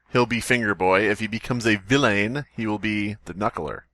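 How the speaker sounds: ordinary delivery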